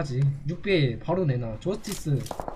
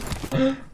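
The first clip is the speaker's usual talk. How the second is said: mock gasp; 9 semitones higher than usual. Mock amazement at partner.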